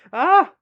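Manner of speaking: sudden high, loud yelp of embarrassment; real embarrassed yelp. Real, not acted. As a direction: squeal as it slips out. Flustered mid-apology to YouTube.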